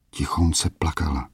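usual speaking voice

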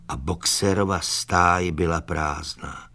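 plain reading voice

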